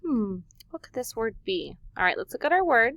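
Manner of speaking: normal talking voice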